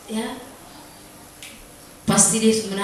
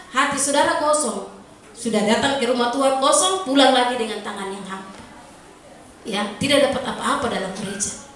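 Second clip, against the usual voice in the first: normal talking voice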